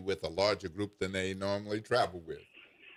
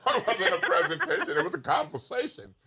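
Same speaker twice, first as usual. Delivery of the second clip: high, loud words through laughter; real laughing; 10 semitones higher, 5 dB louder, faster than usual. Real, not acted. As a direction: explain while cracking up. Joking clarification amid laughter.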